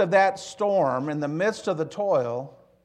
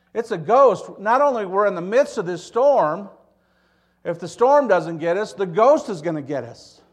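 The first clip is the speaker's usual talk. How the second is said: loud, raised cry of alarm; alarmed disciples; 5 semitones higher, 8 dB louder than usual. Acted. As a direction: call out as panicked men in a storm. Voicing the frightened disciples in the storm.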